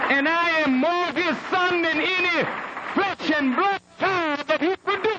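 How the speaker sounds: high-pitched, ringing declaration to the congregation; impassioned preaching. Real, not acted. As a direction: proclaim it at a preacher's full force, pitch soaring.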